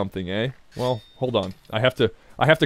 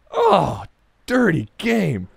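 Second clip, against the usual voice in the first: mock outrage; 4 semitones higher, much slower than usual. Real, not acted. Game steals her drops.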